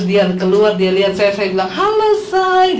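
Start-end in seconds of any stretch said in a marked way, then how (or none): 1.7-2.8 high, drawn-out "hello darling"; coy greeting. Retold or imitated. Wife surprises husband with her new hair, coy high greeting. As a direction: sing out a sweet surprise greeting.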